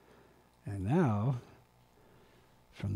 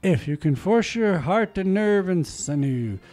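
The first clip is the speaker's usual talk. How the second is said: rousing recital that swells louder at the peaks; dramatic poem reading; 3 semitones higher, 12 dB louder than usual. Acted. Reading Rudyard Kipling's If aloud, dramatic poem recital. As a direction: read Kipling like a stirring speech.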